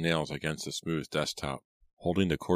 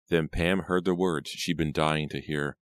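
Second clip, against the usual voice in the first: ordinary delivery, nothing marked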